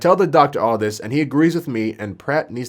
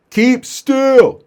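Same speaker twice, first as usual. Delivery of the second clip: high, loud acted command; shouted order; 13 semitones higher, 8 dB louder than usual. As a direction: yell at him to stop moving.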